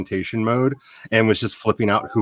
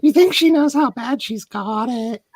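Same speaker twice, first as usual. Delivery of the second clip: put-on joke voice; 13 semitones higher, slower than usual.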